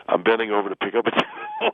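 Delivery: giggling through the line; can't keep a straight face while describing it; words broken up by giggles; laughing. Real, not acted. Laughing through his own description.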